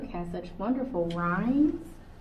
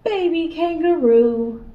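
louder, higher and slowed reveal; grand reveal; 7 semitones higher, 10 dB louder, slower than usual. Acted. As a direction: drag out the big reveal. Big reveal.

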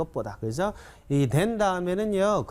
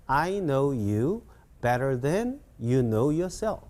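slow, drawn-out English phrases with a pause; measured teacher's read-along pace; slower model sentence; much slower than usual. Shown as a demonstration. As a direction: say it slowly in separate chunks. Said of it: "좀 빨리 시작".

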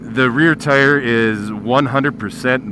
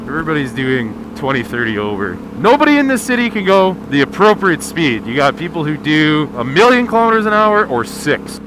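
ordinary talk, nothing marked

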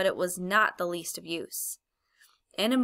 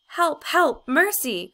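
faint cry; 8 semitones higher, 6 dB louder, much slower than usual. A narrator's reading. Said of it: "cried the witch in a faint voice".

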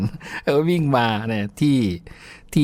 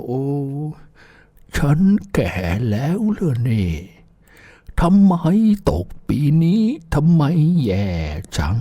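put-on dismayed old person; 4 semitones higher than usual. Old person dismayed at their face in the mirror, mildly wailing. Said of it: "ดูหนังหน้าทางกระจกแล้ว".

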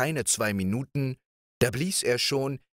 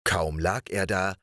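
ordinary delivery, nothing marked

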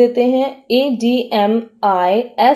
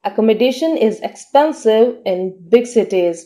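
slow, careful dictation pace; teacher reading an example sentence very slowly; much slower than usual. Shown as a demonstration. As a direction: read it out slowly for learners to follow. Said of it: "sentence में इसका use देखते हैं".

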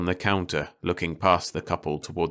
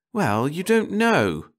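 slow, drawn-out insistence; insisting; 11 semitones higher, 4 dB louder, slower than usual. A narrator's reading. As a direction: press the point, slowly and high. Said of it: "the woman insisted".

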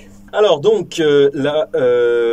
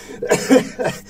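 rueful laugh; 7 semitones higher than usual. Real, not acted. Results went badly.

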